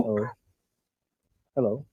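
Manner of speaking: barely audible whisper; faint, almost whispered hello; quiet. Real, not acted. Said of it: "Can you speak louder".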